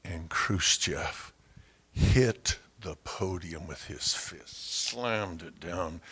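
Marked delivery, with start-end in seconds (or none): none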